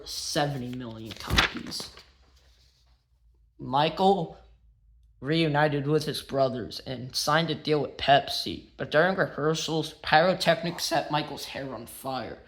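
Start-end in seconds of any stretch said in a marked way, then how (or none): none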